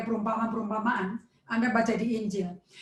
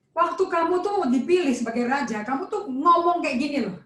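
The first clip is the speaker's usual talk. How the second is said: ordinary talk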